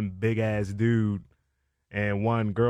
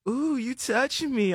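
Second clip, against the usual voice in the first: high, squeaky imitation of a strung-out woman; mock crackhead woman; 13 semitones higher, slower than usual. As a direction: pitch it way up like the spaced-out girl. Quoting the woman.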